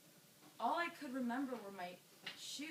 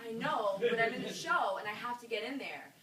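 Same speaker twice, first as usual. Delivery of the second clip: louder, insistent protest to a bouncer; 8 dB louder than usual. Retold or imitated. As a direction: push back urgently, voice raised. Arguing with the club bouncer.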